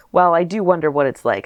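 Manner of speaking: ordinary delivery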